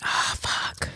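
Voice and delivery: whispered dismay; whispered oh fuck. Real, not acted. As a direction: breathe it in shock. Sad dog news.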